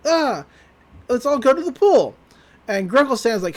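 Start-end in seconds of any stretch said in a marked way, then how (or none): 0.1-2.1 higher and louder, gleeful call to go to the pool; rally everyone like the best news ever; excited cheer. Acted.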